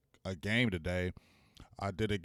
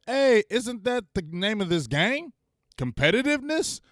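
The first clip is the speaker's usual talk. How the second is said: indignant retort; 11 semitones higher, 5 dB louder than usual. Real, not acted. Rebuts the mocked advice.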